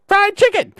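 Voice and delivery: high, loud shrieked yell back; mock yell. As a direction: yell it right back at him. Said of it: "I'd yell at him".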